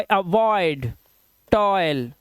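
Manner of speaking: "अवॉइड" and "टॉयल" with a clear oy; word-by-word "अवॉइड, टॉयल", oy glide. Shown as a demonstration. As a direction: sound out oy in "अवॉइड". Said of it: "ओई की आवाज आ रही है".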